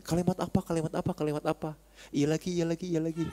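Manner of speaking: whisper-ish nagging among friends; hushed pestering. Retold or imitated. Companions softly pestering Anas about the sentence.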